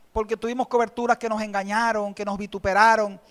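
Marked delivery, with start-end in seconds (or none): none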